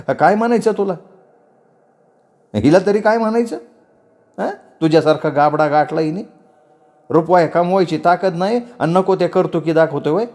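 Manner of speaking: high-pitched, ranting aunt; scolding chachi. Acted. Aunt berates the young man in her house, abusive scolding. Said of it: "तशा शिव्या फेकत होती".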